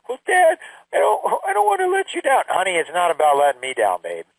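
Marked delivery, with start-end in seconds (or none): 0.1-2.4 high, small pleading voice; upset daughter. Retold or imitated. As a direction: imitate a teen afraid to disappoint her father. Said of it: "And she says".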